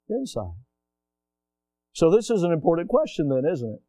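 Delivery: ordinary talk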